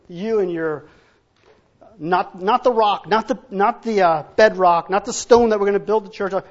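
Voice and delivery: loud, emphatic preaching; loud insistent preacher's denial; preaching at full force. Acted. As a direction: hammer it home with force.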